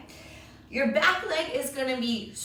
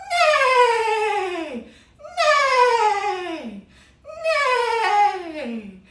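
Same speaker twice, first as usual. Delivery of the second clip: high, loud whinnies; horse neigh; 9 semitones higher, 7 dB louder, much slower than usual. Acted. Teacher neighs like a horse in horse pose, high and loud. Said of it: "what does a horse say".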